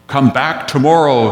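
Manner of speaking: booming mock holler of the wizard. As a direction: holler it like the great Oz. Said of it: "he's hollering at them".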